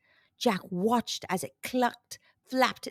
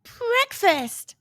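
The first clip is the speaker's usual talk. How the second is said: eager, higher cry of delight; light up at the thought of food; hungry excitement; 12 semitones higher, 4 dB louder than usual. A narrator's reading. Jack spots his breakfast, eager and delighted. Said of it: "thought Jack hungrily".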